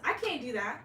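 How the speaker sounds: ordinary talk, nothing marked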